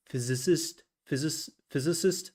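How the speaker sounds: "physicist" stumbled over, with rising pitch; half-laughing struggle with "physicist"; fumbled word. Shown as a demonstration. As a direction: trip on "physis-" then land "physicist" going up. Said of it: "I always love to pronounce that word".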